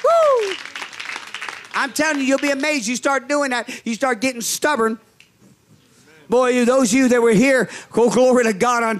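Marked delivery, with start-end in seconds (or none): none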